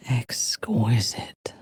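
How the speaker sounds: low, breathed, relishing; slow whisper. Acted. A dark elf whispering her delight. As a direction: savour it slowly in a whisper. Savoring word in game dialogue.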